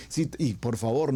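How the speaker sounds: ordinary talk, nothing marked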